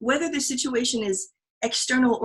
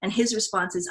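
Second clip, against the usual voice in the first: ordinary delivery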